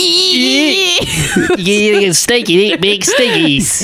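high, slow, laughing fake Kiwi voice; mock Kiwi accent through laughter; bad Kiwi accent. Shown as a demonstration. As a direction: crack up while doing a New Zealander. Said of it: "that's like a Kiwi or something".

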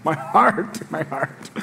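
soft, breathy, moved sigh of a touched father. Retold or imitated. Dad moved that his son wants to come, soft and touched. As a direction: melt like a proud dad. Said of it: "I was like".

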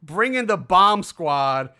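drawn-out imitation of a shouted order; imitated scream. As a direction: call it out like someone off camera. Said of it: "we hear someone off camera scream".

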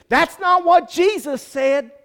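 high, loud, slow denial; shouted rebuttal. Real, not acted. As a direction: cut the excuse off. Preacher rebuts the quote.

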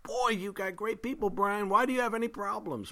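high, gushing mock admiration; admiring visitor. Visiting preacher marvelling at another pastor's people, mock-admiring. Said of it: "and I think".

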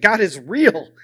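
loud, forceful conviction; emphatic realization. Retold or imitated. All he could think in his cell, said loud and emphatic. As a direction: punch it out as the thought takes hold. Same thought building to conviction.

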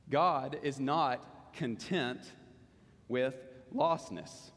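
very slow, pausing before "with lostness"; slow emphatic repeat. Real, not acted. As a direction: drive the point home, phrase by phrase. Main point repeated for emphasis.

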